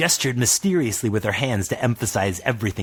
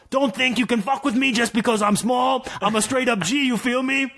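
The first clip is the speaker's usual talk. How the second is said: tough dwarf; 13 semitones higher than usual. Acted. Dwarf gangster talk.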